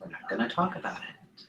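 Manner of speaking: low hushed mocking voice; mock quiet dismissive. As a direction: mutter it like brushing it off. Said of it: "so many of the gays are just".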